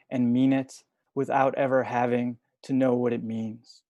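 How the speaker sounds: measured poem recital with pauses between lines; poetry reading. Acted. Poet reading short poems to an online audience, measured recital. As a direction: read each line deliberately, leaving space after it.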